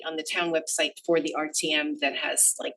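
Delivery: ordinary talk, nothing marked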